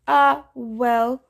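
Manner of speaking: mock-consoling, lightly drawn on the opening sigh of a word; false sympathy. A narrator's reading. Dionysus to thwarted Tantalus, with false sympathy. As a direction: pour on a little fake pity. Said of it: "his voice dripping with false sympathy".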